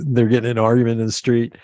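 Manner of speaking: ordinary delivery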